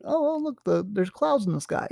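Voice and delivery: mock chirpy chit-chat about the sky; mock small talk. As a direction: point out the obvious to fill a silence. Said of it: "this is a classic, the kind of, like, a small talk thing".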